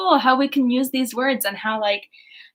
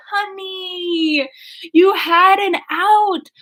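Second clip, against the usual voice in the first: exasperated; 8 semitones higher, 4 dB louder, much slower than usual. Acted. Exasperated aside to Edward.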